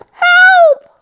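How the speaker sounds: very high, loud yelp; dog shrieking for help; mock cry for help.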